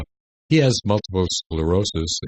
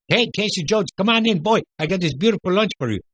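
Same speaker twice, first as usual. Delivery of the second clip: much higher, hammed-up Greek accent, fast at the end; a comic Greek cook greeting a customer in a thick accent; 13 semitones higher than usual. Radio host recalls his Greek cook character, in a high comic Greek accent. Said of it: "I used to talk like this because it's a Greek accent".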